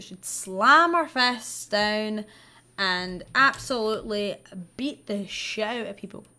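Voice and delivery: normal talking voice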